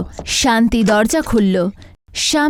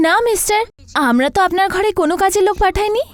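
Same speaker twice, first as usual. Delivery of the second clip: agency woman; 9 semitones higher, faster than usual. Acted. Agency admin voiced by narrator.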